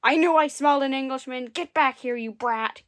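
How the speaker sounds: enraged giant yelling after a thief; angry growl. A narrator's reading. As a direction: chase him down, growling. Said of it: "he growled".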